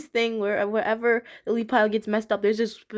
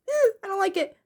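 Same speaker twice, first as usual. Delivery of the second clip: put-on much higher whiny kid voice; childish refusal; 10 semitones higher than usual. Recalling her child self refusing the squirrel scene, whiny. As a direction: whine it like a small scared kid. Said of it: "I was like".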